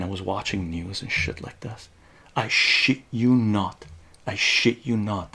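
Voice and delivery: normal talking voice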